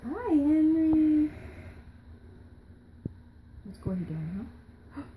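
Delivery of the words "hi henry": louder hello with a long-held name; loud playful greeting. Pretend. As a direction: call it out and stretch the name. Repeats greeting, lower louder take.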